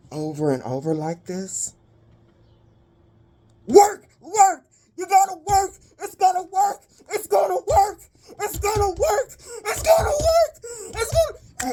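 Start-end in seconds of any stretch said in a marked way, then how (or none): 3.7-8.0 very high, frantic begging; desperate pleading. Retold or imitated. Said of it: "crying, crying, afraid, in fear". 8.4-11.7 very high, sobbing plea; crying. Retold or imitated. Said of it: "crying, crying, afraid, in fear".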